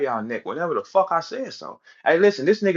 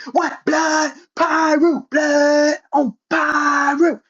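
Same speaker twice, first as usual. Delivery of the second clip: high, drawn-out mock gang cries; mock gangster bluster; 10 semitones higher, much slower than usual. Mocking rival's gangster talk to Cassidy, hammed-up bluster. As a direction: imitate his overblown gang boasting, pitched way up.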